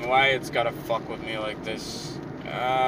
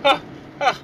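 high, panting imitation of the girl, a little louder; huffing mock relief, pitched high; mock out of breath; 10 semitones higher, 5 dB louder than usual. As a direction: gasp it out like she barely made it. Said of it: "And she's like".

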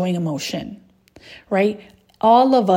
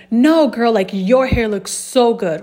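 rebutting; 3 semitones higher, 5 dB louder than usual. Acted. Woman returning a friend's compliment, rebutting. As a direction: throw the praise right back, emphatically. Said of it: "I'm rebuttaling".

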